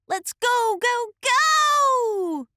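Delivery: high, loud cheer. A narrator's reading. Acorn cheering the squirrel to carry him up. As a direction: urge the squirrel on. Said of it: "Acorn cheered".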